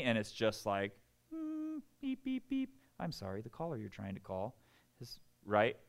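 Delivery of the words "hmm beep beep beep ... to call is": high beeping, then a flat recorded operator voice; automated phone message. Pretend. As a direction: play a disconnected phone line. God feels like a dead line.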